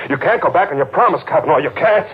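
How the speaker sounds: high, fast, rising panic; pleading, voice climbing near hysteria; desperate high-pitched insisting; frantic insistence. Acted. Man insists he won the bet.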